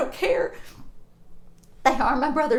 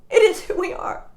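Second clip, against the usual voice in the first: forceful repeat; 6 semitones higher, 7 dB louder than usual. Real, not acted. Repeats point louder.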